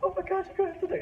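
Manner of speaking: hammed-up wife urging him on; mock excited wife.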